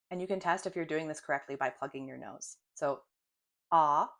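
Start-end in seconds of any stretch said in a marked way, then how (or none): 3.7-4.1 ah held open like at the doctor. Shown as a demonstration. Teacher shows the open vowel, like at the doctor. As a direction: say ah, wide open mouth. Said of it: "just like at the doctor's office".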